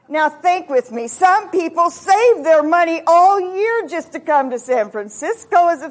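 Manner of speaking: high, hammed-up pleading; insistent wifely persuasion, acted out; campaigning. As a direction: talk him round, building to a loud wail. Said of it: "I have to start my campaign again".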